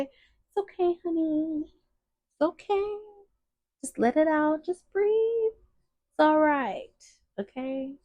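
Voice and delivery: gentle soothing hush for someone in tears; soothing comfort. Pretend. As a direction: coo softly like comforting a crying child. Consoling a crying person.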